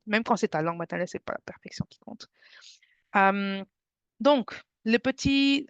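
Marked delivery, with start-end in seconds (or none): 1.1-2.3 breathy whisper to close the point. Real, not acted. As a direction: whisper the motto like a secret. Repeats her motto, quieter each time.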